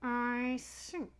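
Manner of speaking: crocodile talking through closed teeth; between the teeth. A narrator's reading. Crocodile holding the elephant's nose, speaking between its teeth. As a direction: force the words out between your teeth. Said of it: "he said it between his teeth".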